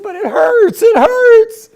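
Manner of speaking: high, loud, growing pained yelp; pained cry. Retold or imitated. Answering his laughing brother right after the shock, a high pained cry. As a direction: cry out that it hurts.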